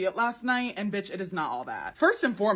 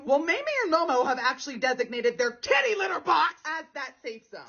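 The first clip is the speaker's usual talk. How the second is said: indignant reveal; 5 semitones higher than usual. Real, not acted. Outraged reveal about cats.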